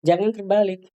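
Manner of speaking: ordinary talk, nothing marked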